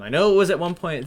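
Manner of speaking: ordinary talk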